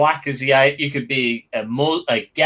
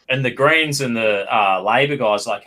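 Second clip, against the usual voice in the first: ordinary talk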